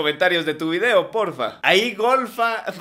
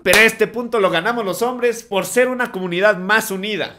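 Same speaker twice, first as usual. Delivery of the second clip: loud mock-triumphant cheer; mock proud rallying shout; 5 semitones higher, 8 dB louder than usual. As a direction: crow it like a sports win.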